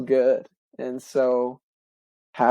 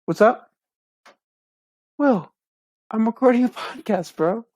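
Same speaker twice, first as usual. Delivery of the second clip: higher-pitched call to an interrupting brother; real aside, voice lifted high; calling to bro; 9 semitones higher than usual. Real, not acted. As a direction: break off and answer the knock, pitched up. Breaks off recording to answer knock.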